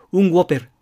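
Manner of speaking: ゴペル said Spanish style, g onset; copying the Spaniard. Retold or imitated. Teacher ordering in Madrid, copying the customer. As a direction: mimic the local way to say ゴペル. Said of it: "その人の真似をして".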